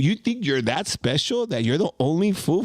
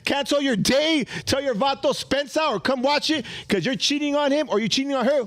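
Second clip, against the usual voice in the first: hype; 6 semitones higher than usual. Acted. Hyping viewers to watch.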